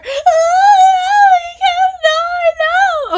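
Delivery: high, loud hammed-up wail; mock wail. Real, not acted. Reader wailing at viewers over the sad scene, hammed-up cry.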